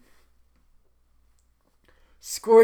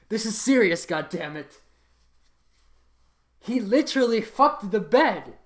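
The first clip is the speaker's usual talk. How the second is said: ordinary talk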